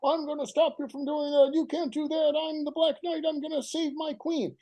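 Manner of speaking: high, brave little knight; child's hero voice. Retold or imitated. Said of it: "I hear him using a different voice".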